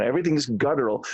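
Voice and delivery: ordinary talk, nothing marked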